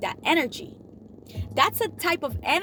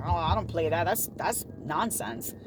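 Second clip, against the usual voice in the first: low, scornful brush-off; dismissive; 8 semitones lower, 4 dB quieter than usual. Retold or imitated. Friend brushing off a lotto win, dismissive. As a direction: wave the news away like it's beneath you.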